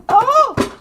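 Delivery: sudden high, loud oh; surprised yelp. Real, not acted. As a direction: caught off guard as cards fall. Drops her cards.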